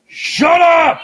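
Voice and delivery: high loud shout. Real, not acted. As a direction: yell it across the bar. Yelling at the bartender.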